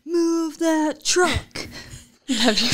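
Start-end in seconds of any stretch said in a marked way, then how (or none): 0.0-1.7 high, very slow held-back yell; drawn-out, high mock yell; mock scream. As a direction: imitate a screaming neighbor, stretching each word. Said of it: "I mean, just screaming".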